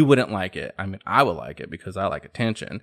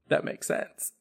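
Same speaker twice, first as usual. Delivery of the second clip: stunned whisper; 8 dB quieter than usual. Retold or imitated. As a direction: whisper "That makes sense" softly, almost breathless. His stunned reaction to mom.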